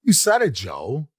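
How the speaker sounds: slow, lightly voiced Walken cadence with odd rises; a touch of Walken's strange rhythm; Christopher Walken. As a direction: drag out the line like Walken. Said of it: "it goes down, it goes up".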